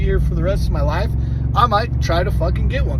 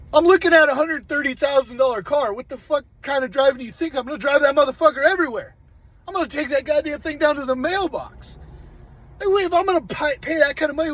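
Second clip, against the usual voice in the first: fast, higher and heated, mock outrage at the question; exasperated retort; 6 semitones higher, faster than usual. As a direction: fire it back like the question is ridiculous. Exasperated reply to salesman.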